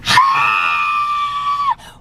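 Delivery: long strained scream after a gasp; gasp, then hold out an acted scream; re-enacted scream. Patient re-enacting ankle being pulled into place, screaming. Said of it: "I'm screaming, like".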